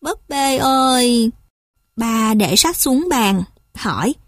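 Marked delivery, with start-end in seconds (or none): 0.0-1.3 slow, drawn-out call, mildly tearful; lightly acted weepy child calling; sniffly crying child. A narrator's reading. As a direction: call out slowly with a touch of sorrow. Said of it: "Ti sụt sịt khóc theo".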